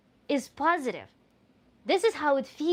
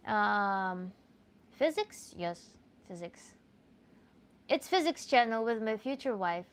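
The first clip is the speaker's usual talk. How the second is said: ordinary talk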